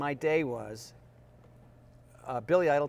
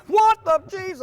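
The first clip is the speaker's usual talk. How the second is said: much higher, much louder exclamation of disbelief; mock shocked yell; 15 dB louder, slower than usual. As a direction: blurt it out in comic shock. Shocked at weak client.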